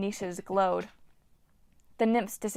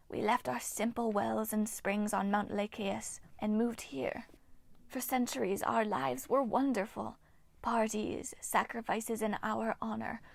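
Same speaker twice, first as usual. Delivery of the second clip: eerie breathy whisper of an ancient nymph; ghostly nymph whisper; 5 dB quieter than usual. Acted. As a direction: hiss it like a withered water spirit. Nymph Hagno speaks.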